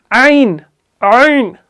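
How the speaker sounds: आइन said light from the throat; correct ain. Shown as a demonstration. Teacher models the correct light ain. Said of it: "आइन ये सही तलफ़ुज़ है".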